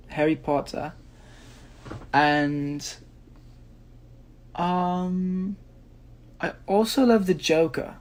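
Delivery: put-on British accent; casually performed British English. Shown as a demonstration. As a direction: answer in an imitation British accent. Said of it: "you want me to do a British accent?".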